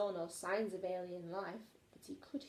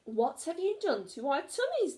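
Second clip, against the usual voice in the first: roaring; 6 semitones higher, 4 dB louder than usual. A narrator's reading. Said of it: "they roared".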